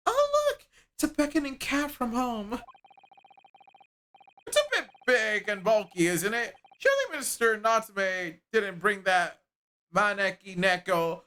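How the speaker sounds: put-on polite young woman reading her game lines; voiced game dialogue. Reads game character dialogue aloud.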